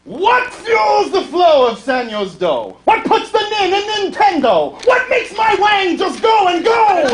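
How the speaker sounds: belted climax. Acted. Singer hits the climax, belting with laughs. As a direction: build to the finale.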